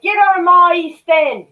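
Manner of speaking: high, loud mock Cockney; exaggerated East End shout; Cockney film quote. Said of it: "Cockney versus Zombies".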